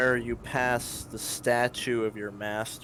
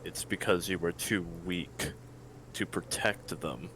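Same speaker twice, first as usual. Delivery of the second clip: deep voice; 5 semitones lower, 6 dB quieter than usual. Acted. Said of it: "a deep voice from behind you".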